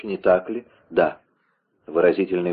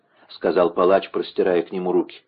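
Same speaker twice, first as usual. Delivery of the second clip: ordinary delivery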